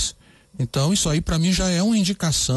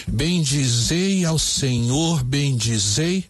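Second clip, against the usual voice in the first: very slow, every syllable spaced out; measured scansion read; syllable counting; slower than usual. Shown as a demonstration. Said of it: "vamos contar".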